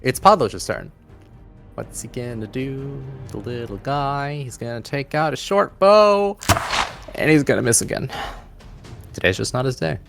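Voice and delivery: usual speaking voice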